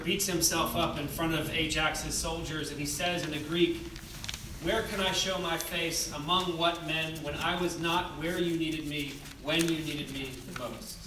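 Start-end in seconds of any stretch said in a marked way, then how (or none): none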